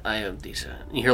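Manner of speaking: usual speaking voice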